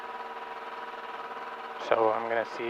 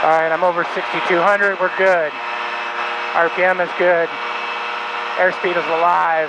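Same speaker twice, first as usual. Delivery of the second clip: raised voice over full-power engine; shouting over engine; 4 semitones higher than usual. Real, not acted. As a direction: call out over the takeoff noise. Takeoff roll, talking over engine.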